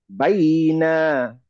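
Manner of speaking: "বাইনা" very slow, the glide held long; light lin stretch. Shown as a demonstration. Teacher modelling for students to repeat, "বাইনা" slowly stretched. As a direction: draw out both syllables of "বাইনা". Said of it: "এখানে একটা হালকা টান হবে".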